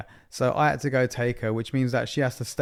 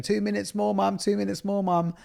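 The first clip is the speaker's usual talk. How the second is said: a lightly voiced pleading child, higher; whiny kid begging; 8 semitones higher than usual. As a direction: beg like a kid who wants more game time. Son begging to keep gaming.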